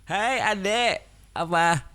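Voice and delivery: put-on high, sweet voice to a little kid; child-greeting voice. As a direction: coo at a small child. (suara manis menyapa adik kecil)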